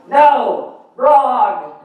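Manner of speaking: high, falling exclamations, each word on its own; emphatic correction. Acted. Correcting the audience in a side note, emphatic and high.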